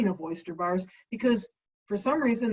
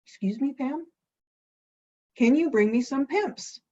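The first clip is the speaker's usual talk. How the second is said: mock disbelief, pitched up; taken aback; 5 semitones higher than usual. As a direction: check you heard right, puzzled. [name] shocked, checking.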